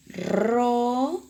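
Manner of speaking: rosa opening on a trilled r; rosa, strong initial trill; rolled r in rosa. Shown as a demonstration. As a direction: roll the first r of rosa. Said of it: "también está comenzando".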